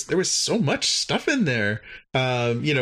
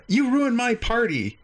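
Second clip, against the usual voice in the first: high, pouting alien voice; sulking alien; 10 semitones higher than usual. Retold or imitated. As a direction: pitch up and sulk. Klar sulks.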